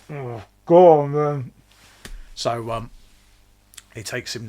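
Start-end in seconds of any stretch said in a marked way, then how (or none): 0.1-1.5 louder, slow, mock resigned; a slow, louder mock shrug of a king; resigned French king. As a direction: give in like a weary king. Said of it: "the French king probably go".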